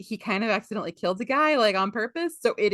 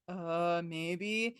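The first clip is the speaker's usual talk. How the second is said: small, soft guilty admission; mock-guilty, hesitant murmur; sheepish; 3 semitones lower, 9 dB quieter than usual. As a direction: imitate a culprit owning up quietly. Pirates' sheepish answer, acted.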